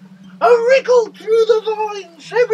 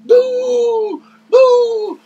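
mock boo; 3 semitones higher, 8 dB louder, much slower than usual. Booing the fox.

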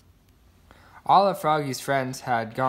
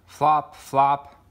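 flop sound effect; 6 dB louder than usual. A narrator's reading. Sound words for hopping.

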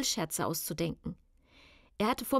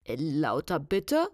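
slow, low official demand; put-on male inspector, drawing it out; lower, deliberate disguised caller; deep man's voice; 4 semitones lower, 4 dB louder, much slower than usual. A narrator's reading. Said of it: "sagte eine tiefe Männerstimme".

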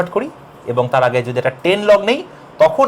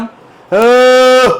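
long high yelled aah; loud shout; 7 semitones higher, 9 dB louder than usual. Shown as a demonstration. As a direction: belt out a sustained aah. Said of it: "মুখের কাছে এনে জোরে চিৎকার দিতাম". (মুখের কাছে জোরে আহ চিৎকার)

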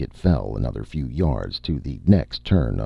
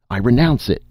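high, loud desperate renouncing; crying out; 10 semitones higher, 5 dB louder than usual. A narrator's reading. Terrified needlesmith crying out his renunciation to the court, high and loud. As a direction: blurt it out in panic. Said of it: "Seth cried".

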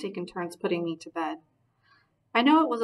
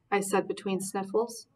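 ordinary delivery, nothing marked

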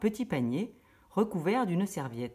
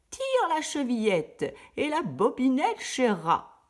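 a slow, lightly faked granny voice; the wolf plays grandma; wolf imitating grandmother; 8 semitones higher, 4 dB louder, slower than usual. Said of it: "en imitant la voix de la grand-mère". (le loup imite mollement la grand-mère)

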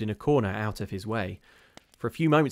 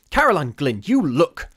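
loud, raised, frightened order; urgent demand; 6 semitones higher, 11 dB louder than usual. A narrator's reading. Missus Brigham to Caroline about the shadow, urgent demand. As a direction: demand that she look, loudly.

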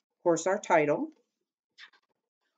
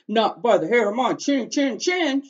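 defiant shout, higher and louder; javelina shouting; 6 semitones higher, 5 dB louder than usual. Acted. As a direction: refuse him loudly from inside the house. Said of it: "shouted the first javelina".

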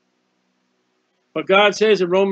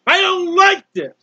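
loud, high pleading cry to the Lord; anguished crying out; 10 semitones higher, 5 dB louder, slower than usual. Acted. A man in anguish crying out to the Lord, loud and high. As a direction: wail it up at heaven in distress. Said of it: "he's in anguish and he's crying out".

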